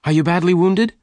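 high-pitched anxious question; crying out. A narrator's reading. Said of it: "Jane cried".

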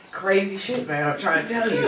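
normal talking voice

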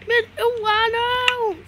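high loud groan of dismay; dismayed cry. Real, not acted. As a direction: wail as you get caught. Caught in game, cries out. (grito de desânimo)

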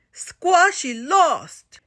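put-on high, slow baby giant voice; little giant. A narrator's reading. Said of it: "said the little giant".

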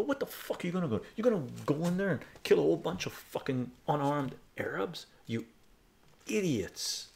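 heated retold outburst at pub strangers; retold rant. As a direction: tear into them again as you retell it. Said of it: "I fucking went off on them".

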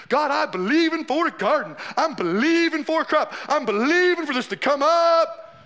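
hammed-up faith declaration with held, ringing words; mock-fervent believer. Preacher mimicking someone praying over a garden, mock-fervent. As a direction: imitate earnest declaring, stretching the key words.

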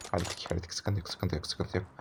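calm yourself down under your breath; relieved murmur. Real, not acted. Calming himself after fight. (kendi kendine alçak sesle rahatlama)

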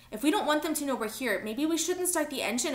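high, urgent suggestion; understated anxious yell; mild yell. A narrator's reading. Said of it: "Amara yelled".

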